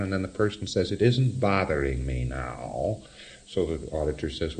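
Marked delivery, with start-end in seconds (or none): 0.9-3.0 low, slow, acted bored indifference; mock-bored, pitched down; boredom. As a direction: drag it out as if past caring. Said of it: "to the boredom band".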